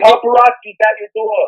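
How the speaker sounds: a light New Jersey twang; lightly put-on New Jersey accent. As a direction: tease her in a mock New Jersey voice. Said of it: "you so sound in Jersey right there".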